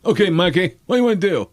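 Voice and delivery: put-on high voice of a brother; brother's voice. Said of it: "he always called me Mikey".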